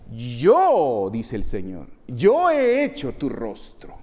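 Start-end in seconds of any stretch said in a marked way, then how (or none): 0.1-1.1 booming, drawn-out YO; loud divine proclamation; the Lord declaring. Acted. Voicing the Lord's emphatic declaration. 2.1-4.0 high, loud, slow proclamation; booming divine declaration; the Lord declaring. Acted. Voicing the Lord's emphatic declaration.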